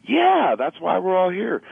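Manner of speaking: high, drawn-out cry of approval; elated agreement. Acted. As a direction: cheer it as the inner artist, stretched out. Acts out his inner artist cheering.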